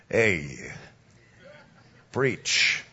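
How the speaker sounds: low East Coast wiseguy voice; mafia tough guy. Retold or imitated. Mobster greets the preacher after church, low wiseguy voice. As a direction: drop low like a mob heavy. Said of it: "sounds j-, exactly like ... he goes".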